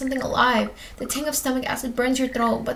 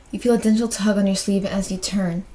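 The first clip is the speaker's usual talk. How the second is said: plain reading voice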